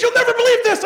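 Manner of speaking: high, loud, bursting with it; excited news. Retold or imitated. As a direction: blurt it out wide-eyed. Friend breathless with news.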